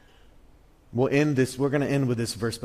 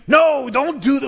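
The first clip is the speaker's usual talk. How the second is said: protesting disciple; 10 semitones higher, 6 dB louder than usual. Retold or imitated. Peter protesting Jesus washing feet.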